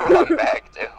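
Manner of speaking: high loud giggling correction; laughing out the words. Real, not acted. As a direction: gasp it out while cracking up. Group laughing at a misread.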